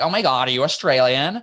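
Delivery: imitating an amazed American; mock American astonishment; mock American accent. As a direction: put on an American accent for a clueless question. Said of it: "when Americans are like".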